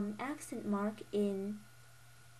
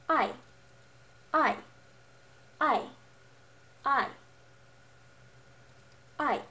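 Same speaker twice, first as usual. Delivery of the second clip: "I" said firmly with a sharp fall; fourth tone; 4 semitones higher, 6 dB louder than usual. Shown as a demonstration. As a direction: drop the pitch hard from high on "I". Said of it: "saying a word firmly".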